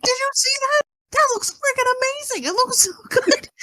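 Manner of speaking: high-pitched, gushing excitement; excited retold yelling. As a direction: reenact calling out in amazement, voice shooting up. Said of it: "I'm yelling to my husband".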